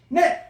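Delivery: high 네 chopped off and falling; short sharp falling ne. Shown as a demonstration. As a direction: bark 네 like a quick yes sir. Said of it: "fourth tone demo".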